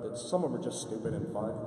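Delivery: normal talking voice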